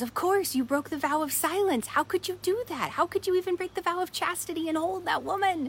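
high-pitched, indignant acted outburst; angry monk. Angry monk berates his companion for breaking vows, acted indignant and high. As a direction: scold as an outraged monk, pitched well up. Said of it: "the first angry monk goes".

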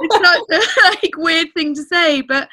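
high, loud tearful laugh; laughing through tears. Real, not acted. Tearful laugh after emotional moment.

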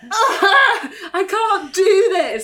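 high, loud, drawn-out mock despair; exaggerated exasperated wail, stretched out slowly; hammed-up overwhelmed meltdown. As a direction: wail it theatrically, half laughing. Acting out being overwhelmed.

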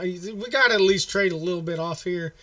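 normal talking voice

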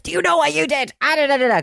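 high, loud mock scolding mother; a mum hissing fury at her kid. Mother telling off her child on the road, mock scolding. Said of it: "she couldn't shout in the road".

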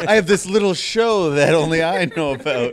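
mocking smug voice, laughing. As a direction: laugh through a smug impression. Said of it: "So smug".

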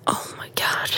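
soft unvoiced whisper, rushed on the second line; panicked whisper. Seeing suited managers at the door, whispered panic. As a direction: whisper it in sudden dread. Suits knock on hotel door.

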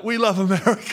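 a hint of hammed-up patriot; mock patriotic. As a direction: ham up cheering for America. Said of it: "He was doing it like".

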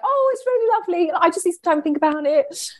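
very high, sing-song politeness; imitating sugary polite fibbing; overly polite. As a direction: gush politely in a put-on high voice. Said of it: "I was so polite back then".

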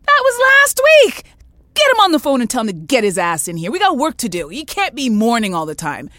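mock furious boss demanding he come in; callous boss yelling. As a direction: bark it like a heartless boss. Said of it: "And she goes".

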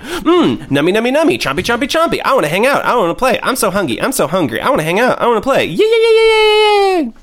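high, eager kitty voice rising to squealed yays; cat voice. Retold or imitated. Cat Maisie begging to eat and play, high and squealing. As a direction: play a greedy happy cat. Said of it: "Maisie's like".

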